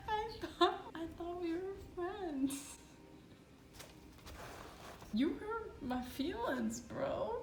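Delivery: high, slow wounded whine; mock hurt. Bitten by her cat, she whines in mock betrayal. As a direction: pretend to be deeply offended.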